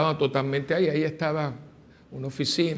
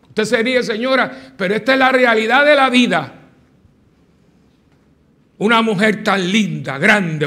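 fervent preaching; 8 semitones higher, 12 dB louder than usual. Acted. Priest urging his congregation, preaching at full force. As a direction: hammer the message home to the pews.

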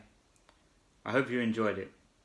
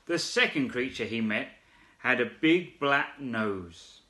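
usual speaking voice

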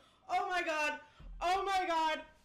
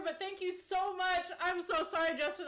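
normal talking voice

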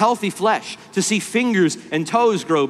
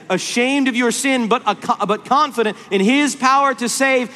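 rising, ringing pulpit voice; fervent preaching; 5 semitones higher than usual. Acted. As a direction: build to a passionate plea. Preaching at full force.

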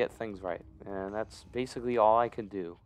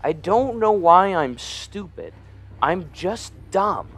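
whiny self-mockery; 6 semitones higher, 5 dB louder, slower than usual. Real, not acted. Berating himself after a mistake.

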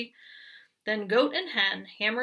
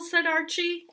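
ordinary delivery

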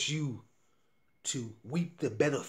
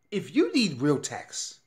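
usual speaking voice

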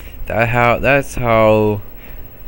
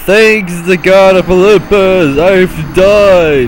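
loud high exclamation; mock triumphant shout; 9 semitones higher, 8 dB louder, slower than usual. Real, not acted. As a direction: shout it out theatrically.

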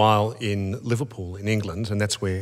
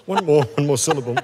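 higher and louder mock nervous counting; 6 semitones higher, 6 dB louder than usual. Interviewer playfully mimics guest counting syllables, higher and louder. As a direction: tease her by counting syllables. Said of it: "Looking at me going".

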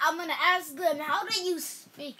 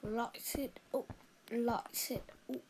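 put-on soft, lightly done British accent; attempted British accent; 8 dB quieter than usual. Boy trying British accent, a bit quieter. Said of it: "you're not talking like a proper British man".

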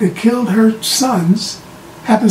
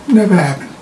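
usual speaking voice

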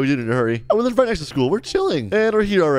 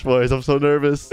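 ordinary talk, nothing marked

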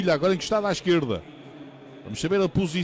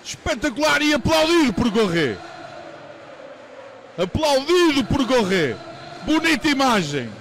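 excited commentary; 4 semitones higher than usual. Real, not acted. After the goal, still excited.

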